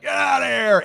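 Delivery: sharp yell to chase off a coyote; yelling at a coyote to scare it off; retold yell. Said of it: "I went out and I yelled".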